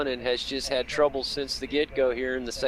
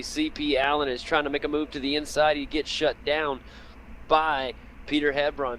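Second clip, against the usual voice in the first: normal talking voice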